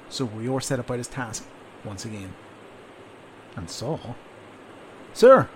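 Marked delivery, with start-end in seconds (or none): 5.1-5.5 high, loud shout; urgent cry. Acted. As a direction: call out urgently to the sergeant. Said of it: "cried Rior".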